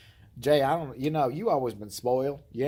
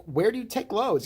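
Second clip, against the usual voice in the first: mock innocent question; 7 semitones higher, 3 dB louder than usual. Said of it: "I'm like".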